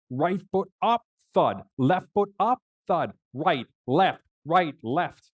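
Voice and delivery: high, strained step-by-step chant; rhythmic, high-pitched effort; effortful climbing count. Retold or imitated. Acts out hauling up stairs.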